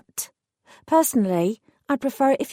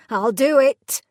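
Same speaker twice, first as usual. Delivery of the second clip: louder higher threatening warning; 6 semitones higher, 5 dB louder than usual. A narrator's reading. Prisoner warning he will tell everyone. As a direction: threaten her with it. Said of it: "he warned again".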